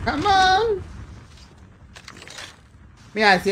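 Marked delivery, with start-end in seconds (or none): 0.0-0.8 "Come on" mucho más agudo, cantado y estirado; lánzalo arriba como grito de ánimo; come on entusiasta. Real, not acted. Su muletilla al jugar. (muletilla de streamer, agudo y animado)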